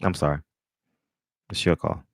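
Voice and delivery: ordinary talk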